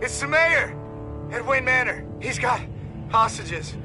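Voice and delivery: high, frantic voice; frightened and urgent, pitched high; panicked report. Acted. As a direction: report a crisis in rising panic. Panicked report of hostage killings.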